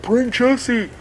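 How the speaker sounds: high, pleading fan voice; high mock crowd plea; clamoring fans. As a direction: imitate fans begging in a high pitch. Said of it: "just fans were like".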